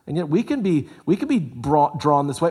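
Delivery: usual speaking voice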